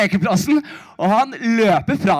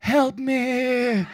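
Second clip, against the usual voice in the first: pathetic plea; 2 semitones higher, 4 dB quieter than usual. Retold or imitated. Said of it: "det mest patetiske jeg har sagt".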